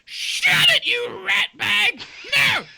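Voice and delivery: very high and loud; high doe snapping; squeaky scolding shout. Acted. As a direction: scold the little fawn. Doe snaps at fawn.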